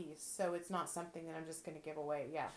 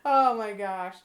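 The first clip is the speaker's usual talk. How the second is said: laughing; 2 semitones higher, 12 dB louder, slower than usual. Real, not acted. Vlogger laughing at her own bossy joke. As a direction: giggle through the words.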